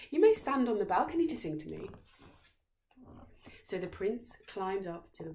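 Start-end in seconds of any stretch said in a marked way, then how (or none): 0.1-1.9 fast, high, loud princess; eager princess. A narrator's reading. Princess eagerly inviting the singer onto her balcony. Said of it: "she said to him again".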